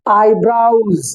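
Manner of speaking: "आईब्राउज" slowly, "brow" rhyming with cow; "आईब्राउज" not "आईब्रोज"; eyebrows with ow. Shown as a demonstration. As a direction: stretch out "आईब्राउज" with an ow vowel. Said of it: "आईब्रोज नहीं है".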